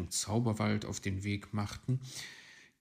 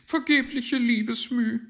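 dismissive magpie; 10 dB louder than usual. A narrator's reading. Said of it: "winkte die Elster ab".

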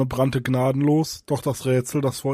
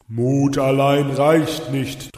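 slow, loud, otherworldly boom; 5 dB louder, much slower than usual. A narrator's reading. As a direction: boom it out like a spirit from the dark. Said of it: "dröhnte die Stimme".

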